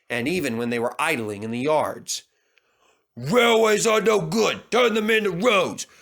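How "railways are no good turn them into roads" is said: rude heckling at passing engines; George the rude steamroller. A narrator's reading.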